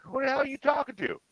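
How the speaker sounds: high-pitched mock exasperation; retold bafflement. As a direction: squeal the question in put-on disbelief. Retells own baffled reaction to chat.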